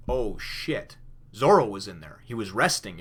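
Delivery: sudden loud, startled outburst; alarmed Luffy. Retold or imitated. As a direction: caught off guard by disaster. Luffy realizes Zoro was inside.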